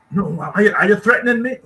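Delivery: mock outraged boss snapping at a worker; imitating shouting boss. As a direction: bark it back like the bullying manager. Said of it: "always shouting at people".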